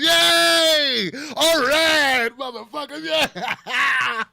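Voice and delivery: loud, high whooping cheer; mock cheering. Comedian mocking people who cheer, loud high whoops. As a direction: whoop like a fan at a win. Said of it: "one of those people going".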